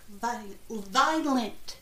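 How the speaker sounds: very slow "violent" sounded out; working out the word "violent" slowly; sounding out. Real, not acted. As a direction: drag out "violent" syllable by syllable. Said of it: "It says V I O L E N T".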